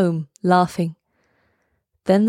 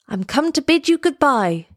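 high farewell call; high, lightly exclaimed voice of a swallow; swallow's cry; 7 semitones higher than usual. A narrator's reading. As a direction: call out the goodbye, pitched well up. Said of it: "he cried".

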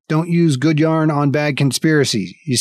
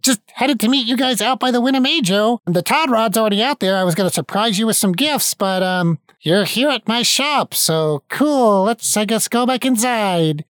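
put-on high, bright-pitched young elf, much higher; elf assistant voice; 8 semitones higher than usual. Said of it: "and he stops and".

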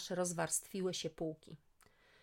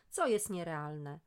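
ordinary delivery

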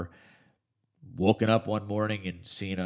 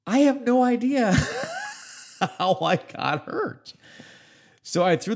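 much higher, laughing in disbelief; baffled laugh; 5 dB louder than usual. Real, not acted. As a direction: squeal it out, baffled and amused. Baffled by mystery scar.

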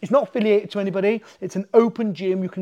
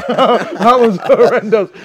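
slow, loud, laughing through the words; slow laughing groan, louder; 4 semitones higher, 11 dB louder, much slower than usual. Real, not acted. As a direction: relive the beating with a loud laugh.

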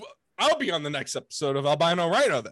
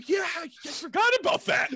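mock shout; 12 semitones higher, 4 dB louder than usual. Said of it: "I go".

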